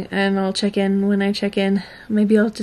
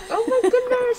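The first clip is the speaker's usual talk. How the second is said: high delighted exclamation; delighted surprise; 14 semitones higher than usual. Real, not acted. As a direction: gush over the cat. Cat rubs past her legs.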